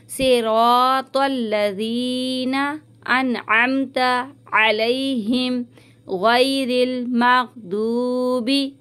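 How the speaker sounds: very slow recitation, सिरातल joined to लजीना; tajweed recitation. Shown as a demonstration. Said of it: "तल लजीना नहीं पढ़िएगा". (धीमी, जोड़कर तिलावत)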